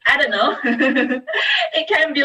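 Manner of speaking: ordinary talk, nothing marked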